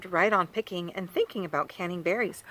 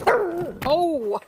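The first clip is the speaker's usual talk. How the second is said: sudden high yelp then a laugh; startled by the cat, laughs it off; surprised laugh; 10 semitones higher, 5 dB louder than usual. Real, not acted. Cat knocks something over.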